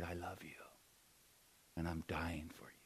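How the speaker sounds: very soft unvoiced whisper. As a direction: breathe it tenderly with no voice. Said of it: "He might have even whispered".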